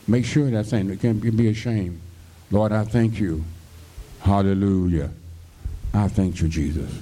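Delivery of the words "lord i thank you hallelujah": usual speaking voice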